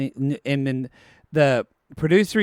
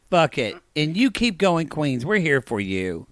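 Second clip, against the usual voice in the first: normal talking voice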